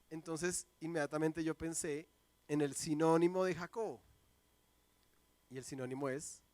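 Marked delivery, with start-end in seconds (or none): none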